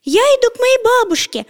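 put-on high, polite girl's voice; sweet, well-mannered little girl. A narrator's reading. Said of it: "ответила Красная Шапочка вежливо".

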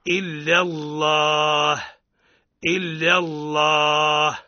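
إلا الله with full tafkhim on the lam; slow, emphatic dark لام in الله; heavy lam. Shown as a demonstration. Teacher demonstrating tafkhim, slow chant. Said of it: "بالتفخيم".

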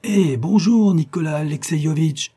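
high, loud, swooping hello; hailing shout. A narrator's reading. Elim greets Nicolas through the speaking trumpet, hailing. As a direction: hail a friend from afar. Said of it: "il cria".

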